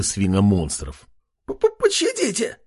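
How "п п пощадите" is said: high, terrified squeal with a stutter. A narrator's reading. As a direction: squeal for mercy like a frightened pig. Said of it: "взвизгнул один из них".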